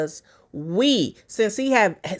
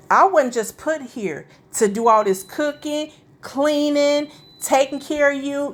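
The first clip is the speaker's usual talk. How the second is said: a higher, sarcastic take on her words; mock victim quote, higher; 6 semitones higher than usual. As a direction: commentator mocks what an abused wife would say, higher and sarcastic. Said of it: "get on camera and say".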